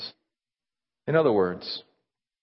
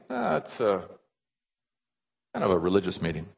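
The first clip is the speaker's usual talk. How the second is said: halting, softer, sinking evasively; reluctant admission; 4 semitones lower, 3 dB quieter than usual. Acted. Churchgoer admitting a religious meeting, reluctant. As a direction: admit it sheepishly with hesitations.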